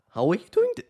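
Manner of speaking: loud, high mock question climbing on "doing"; exaggerated rising pitch through "doing", breaking off; upward inflection. Shown as a demonstration. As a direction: push "How are you doing" sharply upward. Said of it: "you're not saying".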